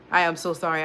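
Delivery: ordinary talk, nothing marked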